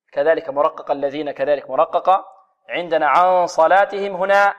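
usual speaking voice

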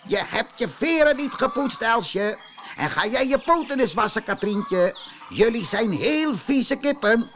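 high, loud scolding; shouting rooster. Acted. Rooster yells at the dirty hens, high and loud. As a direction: yell at each hen in turn. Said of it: "begon hij te schreeuwen".

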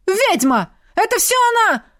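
high, furious shriek; roaring accusation. A narrator's reading. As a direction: scream the accusation at the crowd. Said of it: "Рогнеда тотчас взревела".

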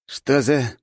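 high baffled rat voice; confused rat man. A narrator's reading. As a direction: ask lost and squeaky. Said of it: "растерялся парень".

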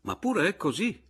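high, loud, condescending reply; superior. A narrator's reading. Young lawyer corrects the client, superior. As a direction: answer from on high, pitch lifted. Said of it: "con aria di superiorità".

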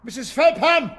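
loud call down the hall. Acted. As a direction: shout a name across the house. Calling housekeeper across house.

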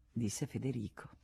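plain reading voice

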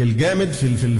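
normal talking voice

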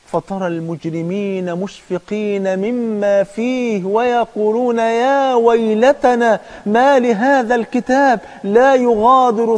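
slow melodic chanted recitation; Quran recitation. Acted. Preacher reciting the verse slowly and melodically.

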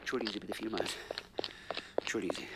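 scolded sidekick apologising softly; meek apology. Acted. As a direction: shrink back after the scolding. Rebuked, apologises meekly.

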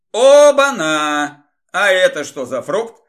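loud, drawn-out mock astonishment; feigned surprise. A narrator's reading. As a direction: cry out as if amazed, stretching the first word. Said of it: "наигранно удивлённо выкрикнул Игорь". (наигранно удивлённый выкрик)

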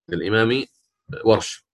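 normal talking voice

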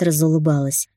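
plain reading voice

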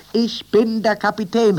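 high, loud, stress on bin; called out. A narrator's reading. Captain calling to the new crew, high loud call. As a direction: announce it proudly over the shoulder. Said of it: "rief er über seine Schulter".

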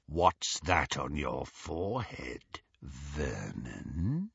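low, slow, hushed questioning; soft menace. A narrator's reading. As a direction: ask it softly like a predator toying with prey. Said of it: "he asked softly".

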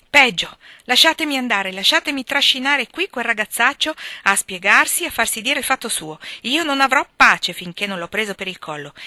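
loud, high-pitched threats; heated, forceful indignation; furious outburst. A narrator's reading. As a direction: demand to be let loose at the culprit. Furious girl threatens the culprit.

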